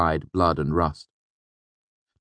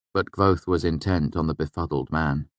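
ordinary delivery, nothing marked

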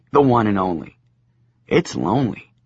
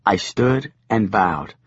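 ordinary delivery

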